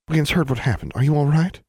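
concerned friend. A narrator's reading. Constance to injured Alexandra, concerned and high-pitched. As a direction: ask after a hurt friend, voice lifted.

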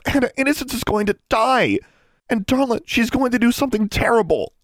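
high, loud tirade climbing in pitch; frantic. A narrator's reading. Alexandra pleads that Innocence is going to die, frantic. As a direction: build from agitated argument to desperate cry.

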